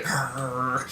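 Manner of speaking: low, soft little growl; lion growl. Retold or imitated. Mom showing her toddler the lion's growl, low and soft. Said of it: "What's a lion say?".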